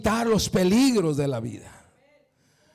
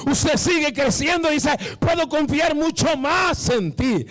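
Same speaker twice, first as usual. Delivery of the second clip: fiery preaching; 4 semitones higher than usual. Acted. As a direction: preach it out, climbing with each line. Pastor preaching at full force.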